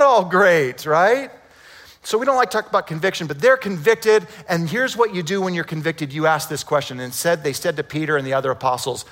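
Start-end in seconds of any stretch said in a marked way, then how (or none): none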